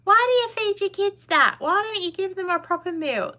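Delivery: high, shrill-sounding mock outrage voice; mocking critics of a mum's meals, high and indignant. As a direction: parody the judgy commenters. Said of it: "And people are like".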